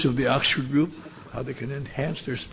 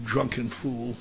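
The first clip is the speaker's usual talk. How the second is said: ordinary talk, nothing marked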